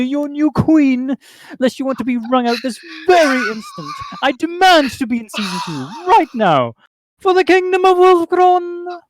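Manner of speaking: loud, commanding evil queen voice; villain queen. Acted. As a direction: demand they bow before you. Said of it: "I need to do this justice".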